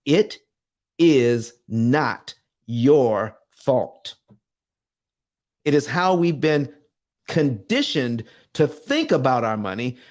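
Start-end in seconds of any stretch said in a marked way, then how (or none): 0.0-4.1 each word slowed right down and set apart; very slow emphatic. Real, not acted. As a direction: press it home slowly, word by word, into the camera. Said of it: "I'm looking dead into the camera".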